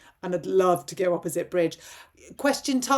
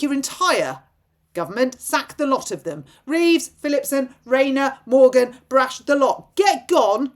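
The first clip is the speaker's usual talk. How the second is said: angry; 5 semitones higher, 5 dB louder than usual. Real, not acted. Angry rant at the Prime Minister.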